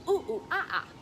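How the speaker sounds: high, loud playful monkey calls; monkey sounds. Shown as a demonstration. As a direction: hoot like a cheeky monkey for the kids. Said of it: "put your arms up like this and say".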